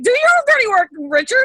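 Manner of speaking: loud high scolding yell; shouting. Pretend. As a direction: yell it at him, fed up. Mock scolding Richard.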